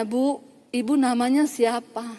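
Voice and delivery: soft, slow imitation of her; softened polite question. Relieved woman asks the counselor's name, softly polite. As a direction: ask gently and respectfully, taking time. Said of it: "Udah beda".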